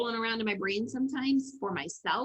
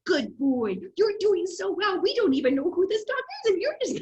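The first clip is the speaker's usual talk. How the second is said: high, louder, encouraging; praising the dog; 9 semitones higher, 5 dB louder than usual. Retold or imitated. Owner praising her nervous dog, high and bubbling. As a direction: cheer the dog on, breaking into laughter. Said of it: "I'm talking to the dog like I've learned to talk to myself".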